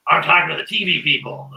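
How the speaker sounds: mock unhinged declaration; crazy man. Storyteller imitates a man asked who he's talking to, unhinged. Mimics crazy man's answer.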